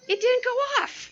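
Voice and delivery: high-pitched incredulous punchline; comic disbelief. Retold or imitated. Telling women the remote failed, comically incredulous. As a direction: pitch it way up in mock dismay.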